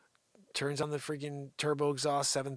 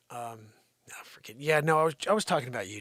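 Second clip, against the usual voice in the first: ordinary talk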